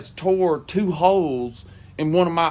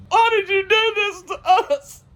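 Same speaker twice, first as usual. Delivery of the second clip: put-on high drunk wail. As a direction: voice jumps way up into a wail. Drunk wailing at ex.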